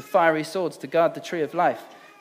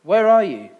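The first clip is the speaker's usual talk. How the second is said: loud searching call; 3 semitones higher, 8 dB louder than usual. A narrator's reading. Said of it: "God called".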